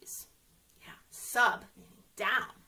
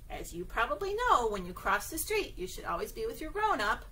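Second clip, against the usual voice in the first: usual speaking voice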